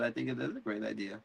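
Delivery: very soft, fast trailing mumble; fading mumble. Retold or imitated. Jacaerys mumbling softly and quickly as he trails off. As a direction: let it die away fast.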